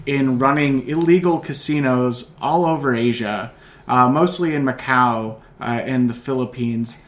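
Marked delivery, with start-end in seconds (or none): none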